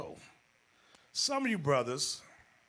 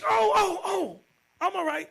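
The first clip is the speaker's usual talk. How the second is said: high, flustered mock wife; wife caught smoking, high and flustered; 13 semitones higher, 5 dB louder than usual. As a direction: cover up fast, pitched way up.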